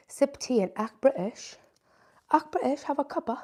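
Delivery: playful attempt at a British accent; lightly hammed-up British; mock British accent. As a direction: put on a not-quite-convincing British accent. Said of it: "Everyone always roasts my British accent".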